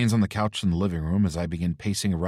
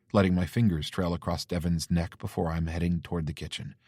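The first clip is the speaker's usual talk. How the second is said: usual speaking voice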